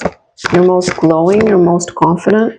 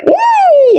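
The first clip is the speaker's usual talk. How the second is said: mock scream. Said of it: "and everyone's screaming".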